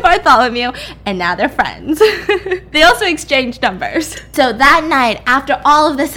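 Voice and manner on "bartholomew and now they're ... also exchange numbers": real laughter breaking into the words; laughing. Real, not acted. Storyteller recaps the two becoming friends, laughing as she talks. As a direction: keep narrating through giggles. Teller laughs while narrating.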